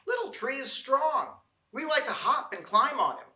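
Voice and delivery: high, quick little squirrel voices; chattering squirrels. A narrator's reading. Squirrels cheering up Little Tree, in chirpy high voices. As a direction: chatter brightly like small squirrels. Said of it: "The squirrels chattered amongst themselves".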